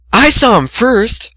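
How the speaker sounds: high slow retort; shooting back. Acted. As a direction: snap back jealously. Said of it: "Fluttershy shot back".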